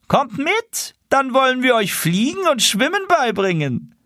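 high, bright goose voice coaxing; high wild goose call. Acted. Wild geese coaxing the tame geese, high goose voice. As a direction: tempt them to come along.

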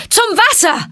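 high, loud final shout; loud call. A narrator's reading. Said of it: "rief er mit lauter Stimme".

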